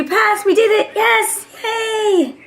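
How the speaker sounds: celebrating her passed class, high and bright; excited cheer. Real, not acted. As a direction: celebrate the pass.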